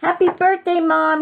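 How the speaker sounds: put-on very high, piping child voice; happy presenting. A narrator's reading. Froggy hands Mom her birthday pie, high happy voice. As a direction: sing it out high and proud.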